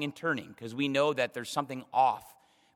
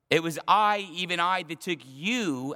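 "I" raised and punched, then slowing on "took you"; emphatic I; 3 semitones higher, 3 dB louder than usual. Retold or imitated. God reminding David who took him, emphatic "I". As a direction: drive home each "I". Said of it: "it's strong".

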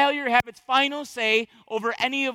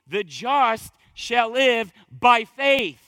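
The just shall live by faith, each word drawn out slowly and louder; slow emphatic quote; 5 dB louder, much slower than usual. Shown as a demonstration. Preacher drives home the verse to the congregation, slow and emphatic. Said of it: "the emphasis is the first part".

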